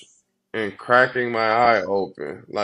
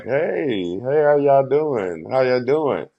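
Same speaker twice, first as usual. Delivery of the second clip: hide the tears behind a breezy hi; fake casual greeting; 4 semitones higher, slower than usual. Passing the girls' cell, he greets them with mock cool. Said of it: "looked at 'em like".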